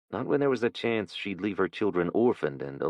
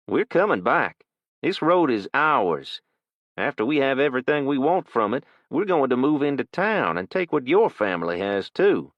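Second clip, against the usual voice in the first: put-on menacing teen thug; 7 semitones higher, 5 dB louder than usual. Thug threatens.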